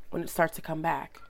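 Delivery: raspy voice from a cold; husky, getting-over-a-cold voice; hoarse from a cold. Real, not acted. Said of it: "I like my sultriness in my voice".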